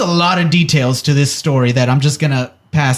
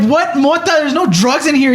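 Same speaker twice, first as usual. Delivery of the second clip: protesting brother; 10 semitones higher than usual. Retold or imitated.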